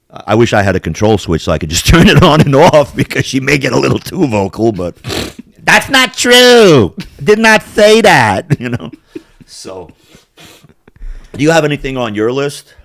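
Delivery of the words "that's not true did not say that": mock denial. Joking retraction, slow and loud.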